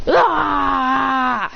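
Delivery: long drawn-out "ah" yell; sustained acted cry; shocked scream. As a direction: scream it out in dismay.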